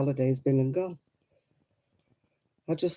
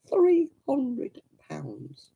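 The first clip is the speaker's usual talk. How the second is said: drawn out slowly, much higher, in disbelief; shocked disbelief; 9 semitones higher, much slower than usual. Real, not acted. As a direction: stretch it like you can't believe the price. Repeats shocking ticket price.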